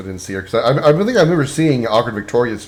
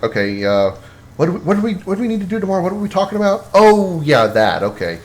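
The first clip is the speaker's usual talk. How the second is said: puzzled, pitched up, searching for the thought; confused inner voice; 6 semitones higher than usual. Retold or imitated. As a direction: mumble to yourself trying to remember. Acting out own forgetful thoughts.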